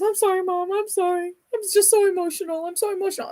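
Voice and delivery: high-pitched mock crying apology; imitating tearful daughter. Daughter apologizing to mom for being so emotional, high mock-tearful voice. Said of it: "she started crying".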